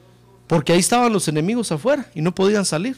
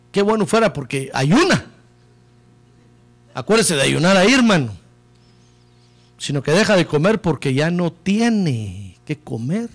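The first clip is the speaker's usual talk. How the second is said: ordinary talk, nothing marked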